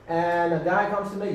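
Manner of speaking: high American anecdote voice; gossipy put-on American accent; dramatic American accent. Shown as a demonstration. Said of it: "you can be more dramatic".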